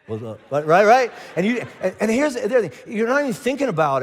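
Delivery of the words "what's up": low, soft, laid-back hello; too-cool greeting. Acted. Teen cruising the town square, playing it cool.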